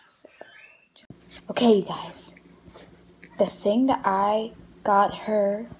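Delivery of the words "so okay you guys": secret whisper to camera. Real, not acted. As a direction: whisper it so she can't hear. Secretly tells viewers about gift.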